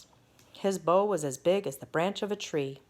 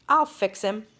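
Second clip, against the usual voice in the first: put-on bossy boy; 4 semitones higher, 6 dB louder than usual. Grand Duke boasts to the king.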